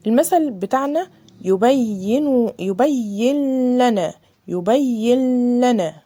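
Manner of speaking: يبين لنا slow, noon merged straight into the lam; slow Quran recitation with full idgham; tajweed recitation. Shown as a demonstration. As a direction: chant يبين لنا slowly with no nasal hum. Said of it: "زي كلمة إيه كده".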